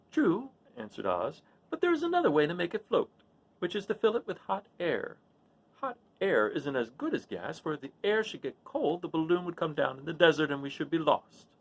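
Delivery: a fussy little man thinking aloud; the little wizard talking Dorothy through his plan. Acted. Oz answers Dorothy.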